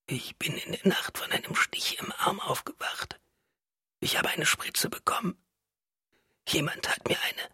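soft, frail whispering; weak whisper. A narrator's reading. Wife recounts the injection to husband, weak whisper. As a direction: whisper it with little strength.

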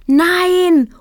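a high, loud cry of no; bride's rival shouting at the wedding. A narrator's reading. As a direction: scream it out across the church. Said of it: "rufe ich".

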